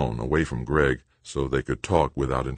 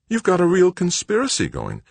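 high-pitched, shocked woman's voice; mother taken aback; 4 dB louder than usual. A narrator's reading. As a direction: react in astonishment, pitch way up. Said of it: "sounding shocked".

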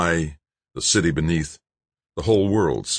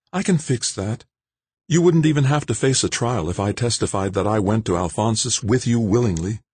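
higher woman's voice, eager; Jane's eager offer; 6 semitones higher than usual. Acted. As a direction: offer to save him as Jane. Jane offers.

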